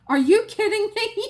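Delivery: loud, very high retold disbelief; incredulous outburst. Patient to technician before the injection, incredulous outburst. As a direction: explode in high-pitched disbelief. Retold outrage at allergy question.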